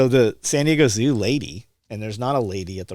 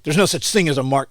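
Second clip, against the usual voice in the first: higher, louder retold objection from the agent; mock indignant agent; 6 semitones higher, 7 dB louder than usual. Agent scoffs at the speaker's ammo explanation, indignant and louder. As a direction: play the agent snapping back in disbelief. Agent disputes practice round.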